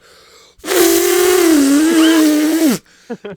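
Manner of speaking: high, loud maniacal laugh. Retold or imitated. Rider laughing as the cart speeds off, loud high cackle. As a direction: let a wild cackle ring out. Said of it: "you hear a loud".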